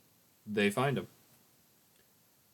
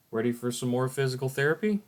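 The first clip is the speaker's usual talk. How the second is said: chirpy caretaker; 5 semitones higher, 7 dB louder than usual. Acted. Upbeat caretaker in flashback.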